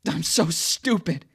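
loud, high, slow outburst with SO hit hard; furious cry at his own stupidity; angry self-reproach. A narrator's reading. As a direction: lash out at yourself. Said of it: "In a sudden surge of anger".

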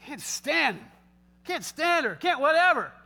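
put-on fed-up grumble, rising at the end; acted disdain. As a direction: huff it out with mounting contempt. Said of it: "your heart just goes".

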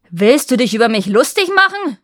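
furious shout. A narrator's reading. The king shouts at the prince he thinks mocks him, enraged. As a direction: bellow at the insolent suitor. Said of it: "schrie er".

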